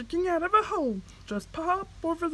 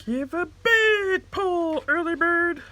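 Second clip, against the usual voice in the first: high, louder, slow encouragement; urging; 11 semitones higher, 6 dB louder, slower than usual. Acted. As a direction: cheer him on to pull. Worm urging the bird.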